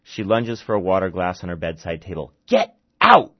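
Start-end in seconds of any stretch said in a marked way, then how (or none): 2.5-3.3 high, loud furious snarl; snarled get out. Acted. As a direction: drive the doctor away, snarling. Lunging for glass to throw.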